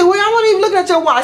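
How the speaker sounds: high, fast retort; mock defensive. Customer denying she looked at the wallet, mock defensive. Retold quarrel over wallet.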